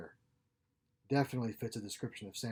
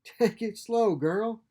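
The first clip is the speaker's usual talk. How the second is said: pitched up, caring sister; Nuala's voice; 10 semitones higher, 7 dB louder, slower than usual. A narrator's reading. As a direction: catch her gently but high and bright. Nuala catching her sister.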